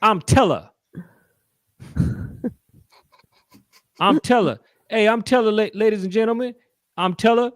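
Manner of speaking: hammed-up rowdy stage host; Teller impression. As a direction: hype the crowd like a loud showman. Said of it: "he goes like this".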